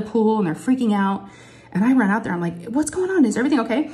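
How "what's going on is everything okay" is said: high-pitched urgent questions; imitating a worried adult's alarmed check; retold alarm. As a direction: reenact running out to check on screaming kids. Retold alarmed rush to screaming girl.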